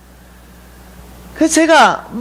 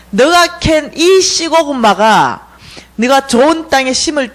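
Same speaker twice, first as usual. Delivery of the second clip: slow, stretched, emphatic proclamation; dream messengers' pronouncement; slower than usual. Retold or imitated. Dream visitors grant her a sweet potato field, slow proclamation. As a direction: proclaim it grandly, drawing out key words. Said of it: "이렇게 말하는 거예요".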